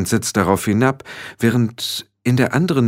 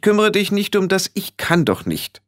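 hoch, bestimmt, ohne Pause; scharfe Zurechtweisung; 11 semitones higher than usual. A narrator's reading. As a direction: den Einwand energisch wegwischen. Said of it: "sagte Frau Wetterwachs scharf". (scharf und gebieterisch)

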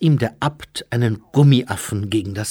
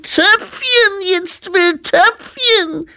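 put-on very high, slow, loud toddler whine; drawn-out squealing baby demand; baby demanding potty; 6 dB louder, much slower than usual. A narrator's reading. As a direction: wail for it like an insistent infant. Baby abbot demands his potty again.

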